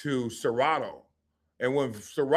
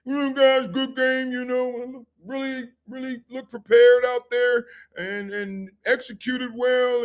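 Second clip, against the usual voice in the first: imitating Wade Phillips; 9 semitones higher, 4 dB louder than usual. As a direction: play the coach congratulating the team. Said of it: "Wade Phillips came in".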